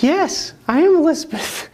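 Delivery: very high, loud, grand announcement; hammed-up high reply from Elizabeth; mock grand reply. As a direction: answer like a proud heroine. Elizabeth answers, retold.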